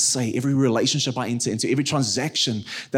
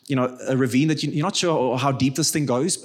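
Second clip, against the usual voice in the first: normal talking voice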